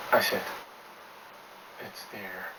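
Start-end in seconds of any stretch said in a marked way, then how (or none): 1.8-2.5 staring into space, he mutters under his breath; muttered. Acted. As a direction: mutter it barely aloud, transfixed. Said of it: "he muttered".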